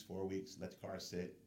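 usual speaking voice

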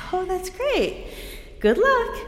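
high-pitched, put-on cheer; mock encouragement. Retold reply to friend's speaking news.

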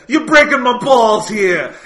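loud, high, slow, hammed-up Italian American accent; mock a cartoon mobster, drawing the words out; Viggo Mortensen Italian accent. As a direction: friends trading a favourite movie line in a mock Italian accent. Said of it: "in Viggo Mortensen's dumb Italian accent".

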